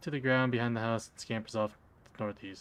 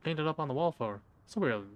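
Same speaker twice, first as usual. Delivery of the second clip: ordinary talk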